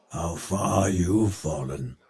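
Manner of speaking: low, softened scorn; quiet, low disdain; disappointed contempt. Acted. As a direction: drop low and soft, looking down on a fallen hero. Villain pities a fallen hero.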